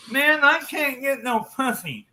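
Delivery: slow, high harelip impression; harelip friend. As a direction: mimic a lisping friend complaining. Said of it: "a friend who's a hair lip".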